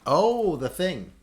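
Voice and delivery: high, slow dawning "oh"; sudden realization. Real, not acted. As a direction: drag it out as it clicks. Sudden recognition.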